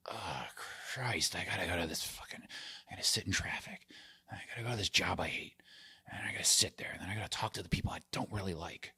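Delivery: hushed, low groggy grumble; dreading morning grumble. Acted. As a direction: grumble under your breath, dreading the workday. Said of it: "they wake up and they're like".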